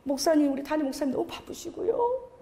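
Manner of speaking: ordinary talk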